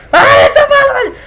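very high, loud startled cry; scared shriek. Real, not acted. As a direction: shriek in fright. Startled by new enemy.

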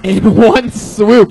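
loud, high, hyped-up announcing; hype host. Real, not acted. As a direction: pump up the crowd. Host hyping the roast battle.

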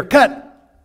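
high, loud call to stop the take; director's shout. Acted. Director shouting cut to the actors, loud high call. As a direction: snap the take shut from behind the camera.

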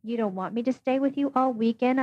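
usual speaking voice

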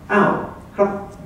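mock confident, like you've nailed it; proud. Ordering a beer in Thai, proud and confident. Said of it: "So I said proudly, with confidence".

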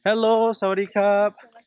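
loud, slow, friendly call; calling out greeting. Real, not acted. Greeting locals on arrival.

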